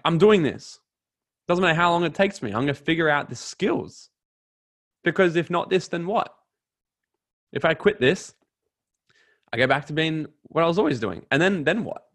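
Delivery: firm, insistent pep talk to oneself, acted out; determined self-talk. Coach quoting own early resolve when starting network marketing, determined.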